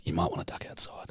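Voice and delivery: hushed warning whisper; retold whisper. Brother whispers a warning to Jack, hushed whisper. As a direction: whisper it like a tip-off to a mate. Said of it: "He goes".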